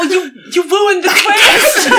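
high, loud, slow laughing complaint; laughing mock outrage. Real, not acted. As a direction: laugh out a fake protest that he ruined the twist. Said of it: "mock outrage at a spoiler".

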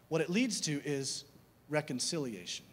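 normal talking voice

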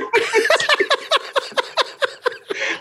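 a burst of high laughter, way up; high-pitched laughing fit; high cackling laugh. Real, not acted. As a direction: crack up at your own punchline. Punchline of the story.

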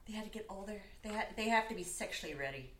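normal talking voice

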